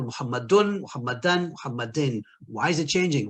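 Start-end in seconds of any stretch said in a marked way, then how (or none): 0.0-0.8 Muhammadun with an "-un" ending. Shown as a demonstration. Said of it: "changes in ending. Example". 0.8-1.5 Muhammadan with an "-an" ending. Shown as a demonstration. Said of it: "changes in ending. Example". 1.5-2.2 Muhammadin with an "-in" ending. Shown as a demonstration. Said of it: "changes in ending. Example".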